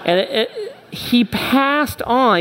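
high voice cracking between a laugh and tears; voice breaking. Real, not acted. Preacher moved by his mentor, voice breaking with emotion. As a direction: let it catch in your throat as it comes.

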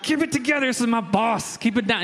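retelling an embarrassed plea to a giggling wife; urgently shushing someone, played for comedy; mock urgent plea. Retells pleading with laughing wife.

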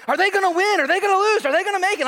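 hammed-up nervous fan rooting on the couch; mock tense viewer questions; tense excitement. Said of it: "'Cause I'm like".

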